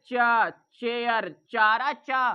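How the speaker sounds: drawn-out चा, then चेयर and चारा, each syllable slow and loud; sounding out the first syllable. Shown as a demonstration. Teacher to class, sounding out चा slowly and loudly. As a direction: stretch the चा so the class hears the चा sound. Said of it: "चा का आ रहा है".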